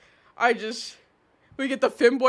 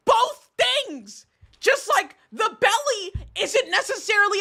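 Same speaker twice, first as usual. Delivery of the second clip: high-pitched, drawn-out emphatic protest; real high, exasperated insistence; exasperated arguing; 7 semitones higher than usual. Real, not acted. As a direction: spell it out slowly, at the end of your patience. Heated, exasperated argument with chat.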